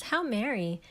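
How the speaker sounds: plain reading voice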